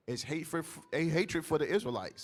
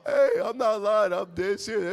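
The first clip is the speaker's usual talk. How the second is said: loud insistence; 5 semitones higher, 8 dB louder than usual. Real, not acted. Laughing insistence after story.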